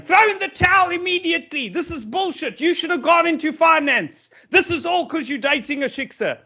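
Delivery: high-pitched, nagging mock mother. Comedian imitates the boxer's mother begging him to quit, high nagging voice. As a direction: squawk it like a panicking mother at ringside. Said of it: "His mother ran in saying".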